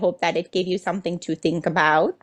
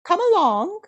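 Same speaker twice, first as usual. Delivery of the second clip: cheerful welcome; 12 semitones higher than usual. Pretend. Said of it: "And we'll say".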